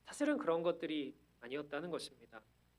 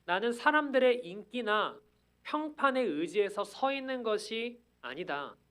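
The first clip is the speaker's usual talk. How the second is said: high, loud preacher's proclamation; ringing declaration in the apostle's words; Paul's bold declaration; 5 semitones higher, 6 dB louder than usual. Acted. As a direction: proclaim it forcefully, voice raised. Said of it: "오늘 바울이 말하는 바는".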